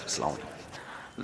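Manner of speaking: soft, slow, near-whispered goodbye; a slow, breathy, timid salaam as he backs off; mock meek farewell. Thin man leaves, mock polite farewell.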